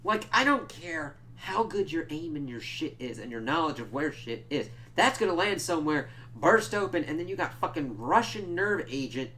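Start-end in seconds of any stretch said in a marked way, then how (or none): 5.0-9.3 worked up. Real, not acted. Ranting about thrown nerve agent.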